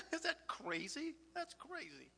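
real tears breaking through the words; tearful. Real, not acted. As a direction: ask it while nearly crying. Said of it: "I'm up here crying".

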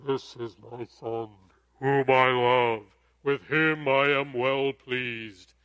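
slow, deep, booming voice of God. Shown as a demonstration. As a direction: intone it like God speaking from heaven. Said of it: "I'll try to do my best God, God voice".

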